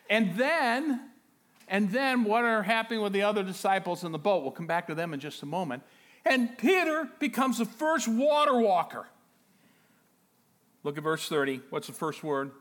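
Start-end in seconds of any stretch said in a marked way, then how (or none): 0.1-9.1 loud, high, excited preacher delivery; full-force preaching. Acted. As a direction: preach it out with rising urgency.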